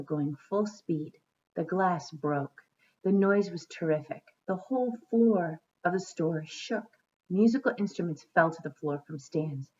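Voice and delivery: storybook narration; story reading. A narrator's reading. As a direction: read to children at an even pace. Reads a storybook aloud.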